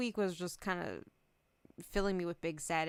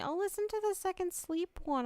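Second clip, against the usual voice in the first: high-pitched quoting of insistent fans; imitating everyone's urging; 11 semitones higher than usual. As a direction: lightly mimic people nagging you, pitched up. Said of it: "everyone keeps telling me".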